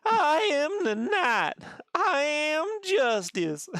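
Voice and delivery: high, drawn-out country drawl; botched English accent that comes out country, very slow. Acted. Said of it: "Very country like".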